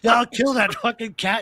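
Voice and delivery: high mock angry yell at the cat; mock furious dad; imitating dad's rage. Father's rage retold by son.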